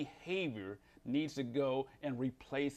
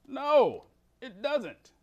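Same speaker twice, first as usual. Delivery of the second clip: emphatic no; 12 semitones higher, 12 dB louder, much slower than usual. Real, not acted. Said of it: "emphatic answer".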